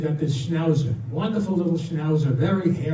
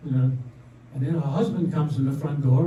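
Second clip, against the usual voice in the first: ordinary talk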